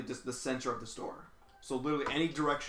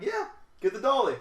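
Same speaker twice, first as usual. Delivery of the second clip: higher and louder; mock cheerful order; 10 semitones higher, 6 dB louder than usual. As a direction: chirp the order with glee. Taunting coworker cheerfully.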